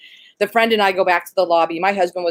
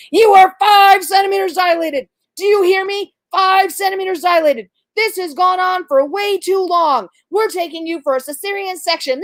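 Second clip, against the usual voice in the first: mimicked scolding doctor; 10 semitones higher, 3 dB louder than usual. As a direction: berate a patient as an overbearing doctor, starting loud, easing slightly. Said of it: "mimicking her voice made me cough".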